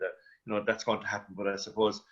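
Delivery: ordinary delivery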